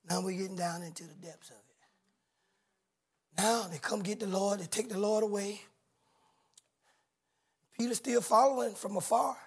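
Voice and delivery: soft, low preaching; solemn hushed preaching; hushed sermon. Acted. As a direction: pull the church in close, quietly. Preacher drops into hushed register.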